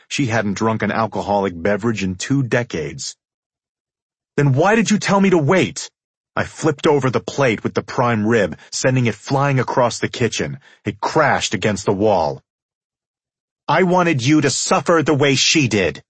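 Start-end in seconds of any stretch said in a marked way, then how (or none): 4.4-5.9 raised, loud and high; heated, high-pitched challenge; angry demand. A narrator's reading. Narrator flips plate in anger. 13.7-16.0 high, loud woman's retort; pointed, raised-pitch rebuke; female character. A narrator's reading.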